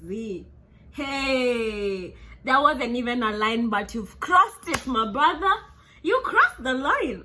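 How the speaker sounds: loud, high, slow scolding; government scolding. Retold or imitated. Government warning the hitman he crossed the line, loud mock scolding. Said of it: "the government was like".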